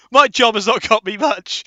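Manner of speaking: usual speaking voice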